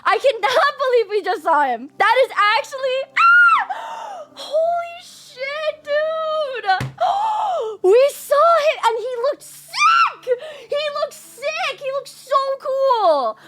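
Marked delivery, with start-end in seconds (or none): 3.2-13.4 freaking out. Real, not acted. Fan shouting after meeting her favourite villain. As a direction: gush and shout about the character. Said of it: "I'm done freaking out".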